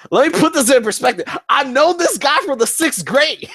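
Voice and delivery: high, loud, disbelieving; incredulous emphasis. Real, not acted. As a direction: stress how absurd it is. Incredulous emphasis on long friendship.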